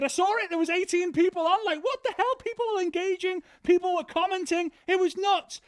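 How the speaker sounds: high, astonished voice of dad; dad's voice up high in disbelief; amazed dad. Retold or imitated. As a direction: play the dad, blown away.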